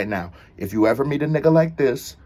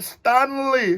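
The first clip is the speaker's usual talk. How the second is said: lightly mimicked drawn-out Stanley; imitating her accent; 10 semitones higher than usual. Repeating the girlfriend's call, in a light accent imitation. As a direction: echo her accented Stanley, a little higher. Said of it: "her accent ain't playing".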